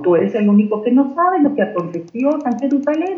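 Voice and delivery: asombro fingido, agudo y rápido; incredulidad actuada. Acted. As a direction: contesta como quien no puede creer la pregunta, más agudo. Discípulos asombrados ante la pregunta. (incredulidad actuada, voz aguda y apresurada)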